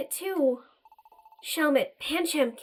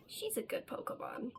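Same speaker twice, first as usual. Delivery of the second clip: whisper; 14 dB quieter than usual. Real, not acted. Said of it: "whispered aside".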